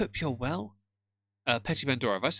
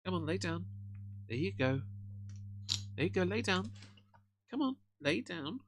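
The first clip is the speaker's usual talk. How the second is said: high, soft pet talk; coaxing the dog; 7 semitones higher, 6 dB quieter than usual. Real, not acted. Owner settling his dog on his lap, soft high coaxing. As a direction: gently coax her to lie down, high and hushed.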